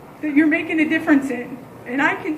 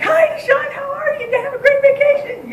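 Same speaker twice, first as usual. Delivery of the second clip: much higher, sing-song overly cheerful hello; fake sweet greeting; 12 semitones higher, 3 dB louder than usual. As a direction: greet him with forced sweetness. Greeting the bad kid returning.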